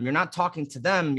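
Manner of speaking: ordinary delivery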